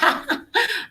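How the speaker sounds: high, amused laugh; real laugh. Real, not acted. Coach amused by an attendee's comment, laughing. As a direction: burst out laughing at the remark.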